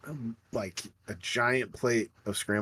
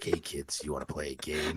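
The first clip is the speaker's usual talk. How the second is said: lower, soft creepy villain voice; Saw villain; 8 semitones lower, 8 dB quieter than usual. Pretend. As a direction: invite the kids to a deadly game.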